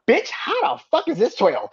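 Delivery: high, slow mock outrage at "Bitch, how the fuck is this twelve"; half-laughing fake fury over a ranking; mock outraged rant. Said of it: "I got so upset".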